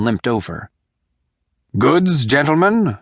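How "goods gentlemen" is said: slow, higher wheedling whine; whining peddler. A narrator's reading. A lightly voiced beggarly whine. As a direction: peddler whines his sales pitch at the two men. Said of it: "he whined".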